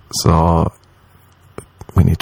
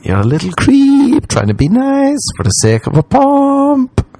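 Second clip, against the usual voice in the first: very high, teasing squeal; mocking taunt. Comic calling out fake nice guys, high mocking taunt. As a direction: taunt them in a soaring mock voice.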